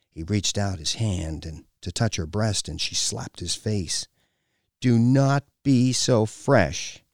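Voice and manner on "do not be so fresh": slow, louder, higher reprimand; stern scolding. A narrator's reading. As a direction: scold him word by word. Woman scolds him after slapping him.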